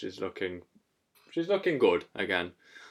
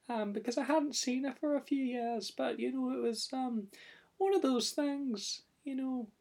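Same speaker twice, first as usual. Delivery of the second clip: wee Scottish puppet. Acted. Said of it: "tell us just a sentence about your mum".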